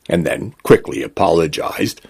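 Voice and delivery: usual speaking voice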